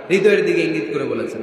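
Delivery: normal talking voice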